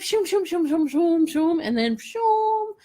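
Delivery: high-pitched run of whooshes, the last drawn out; playful whooshing noises. Retold or imitated. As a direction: voice the universe zooming things around. Acting out universe rerouting her plans.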